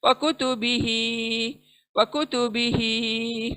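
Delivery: वकुतुबिही with only ही long, the rest quick; slow tajweed reading of वकुतुबिही; drawn-out recitation. Shown as a demonstration. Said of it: "सिर्फ एक ही खींचना है".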